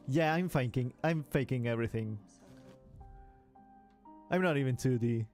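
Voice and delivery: ordinary talk, nothing marked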